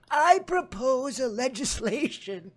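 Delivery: put-on high, slow old-lady voice; grandma in Congress. Grandma addressing Congress with her proposal, high put-on old-lady voice. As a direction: propose it as a doddering grandma, slowly.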